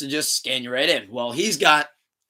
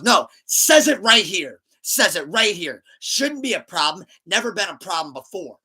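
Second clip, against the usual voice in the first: pushy imitation of an indignant friend; aggressive insistence; 4 semitones higher, 5 dB louder than usual. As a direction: insist on it, hitting each phrase hard. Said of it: "He's getting all aggressive".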